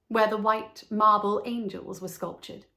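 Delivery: usual speaking voice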